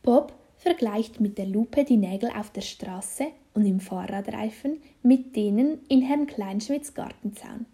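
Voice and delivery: ordinary delivery, nothing marked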